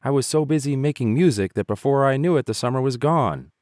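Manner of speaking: sorry grasshopper whining. A narrator's reading. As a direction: whine it out like a lazy fiddler. Grasshopper voice continues.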